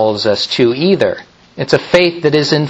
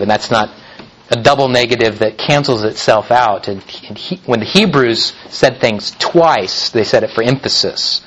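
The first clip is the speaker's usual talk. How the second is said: ordinary talk, nothing marked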